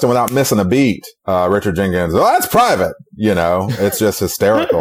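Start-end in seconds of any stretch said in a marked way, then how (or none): 2.1-2.9 much higher, prim and offended; prim high character voice. Retold or imitated. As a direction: squeak it out, primly offended. Quoting Chicory's prim reply.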